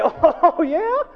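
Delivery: high, loud, drawn-out scoff; mocking heckler. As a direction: jeer in disbelief, stretching it high. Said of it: "old Harry behind me is gonna say".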